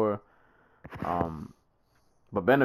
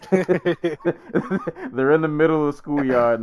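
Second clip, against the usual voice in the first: laughing; 6 semitones higher, 4 dB louder, slower than usual. Real, not acted. Laughing while describing fight.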